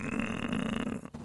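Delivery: very soft, drawn-out moan; hushed mock-sick groan; queasy groan. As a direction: groan quietly to yourself in the corner. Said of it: "I'm just in the corner".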